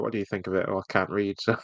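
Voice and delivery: ordinary delivery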